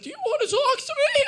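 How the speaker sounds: very high, loud, rushed squeak; absurdly high creature-like squeal; seahorse squeal. Acted. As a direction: squeal it out fast at the top of the voice. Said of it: "I just sounded like a seahorse".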